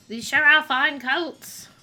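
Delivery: slow, lightly put-on British accent; a touch of British accent on the coat line; mock British accent. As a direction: read it in a hint of a posh British accent. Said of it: "some kind of weird British accent".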